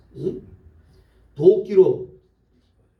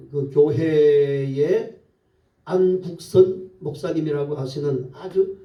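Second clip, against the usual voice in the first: ordinary talk, nothing marked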